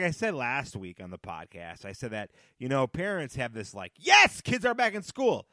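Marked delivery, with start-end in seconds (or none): 4.0-5.5 high, loud mock cheer; celebrating parent. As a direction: voice a triumphant, relieved parent. Voices parents cheering school's return.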